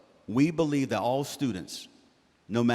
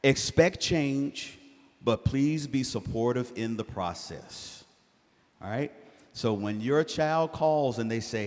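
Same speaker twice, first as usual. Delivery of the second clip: normal talking voice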